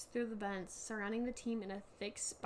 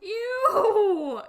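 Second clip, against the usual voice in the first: high, loud laughing "ew"; disgusted squeal; 9 semitones higher, 14 dB louder than usual. Real, not acted. Disgust at screaming burrito.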